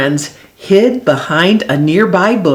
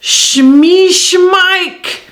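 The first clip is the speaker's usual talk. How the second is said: high, put-on monster cry; half-hearted roar, pitched up; mild monster roar; 10 semitones higher than usual. A narrator's reading. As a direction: call out as a storybook monster. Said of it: "roars the terrible monster".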